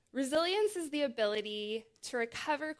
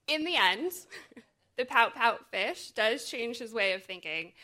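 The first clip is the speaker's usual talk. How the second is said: ordinary talk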